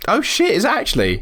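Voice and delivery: high-pitched surprised question. Real, not acted. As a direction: react to unexpected news, pitch jumping up. Learns creator is linked to Yogscast.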